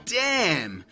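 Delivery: ordinary talk, nothing marked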